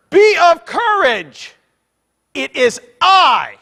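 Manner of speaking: slow, high, forceful proclamation; emphatic tone. Shown as a demonstration. Preacher voicing Jesus on the water, slow emphatic command. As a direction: proclaim it word by word at full force. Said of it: "it's in an emphatic tone".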